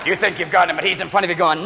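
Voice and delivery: ordinary talk, nothing marked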